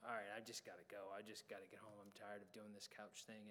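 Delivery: low, soft grumble to himself; weary grumble. Retold or imitated. Tired helper grumbling about the couch and wanting home, weary low mutter.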